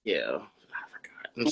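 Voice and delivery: soft whispered aside; sheepish whispered admission. Real, not acted. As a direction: drop to a whisper, embarrassed. Apologetic aside about forgotten name.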